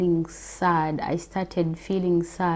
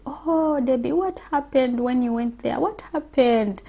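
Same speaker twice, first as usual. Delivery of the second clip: a higher, lightly voiced curious friend; friend pressing on; 7 semitones higher than usual. Friend begging Debbie to explain, lightly raised curious voice. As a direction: lift the voice to play the prying friend. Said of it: "And she was like".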